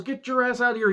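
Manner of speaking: high, dismissive put-down; mock heel throwing someone out; mock wrestler bark.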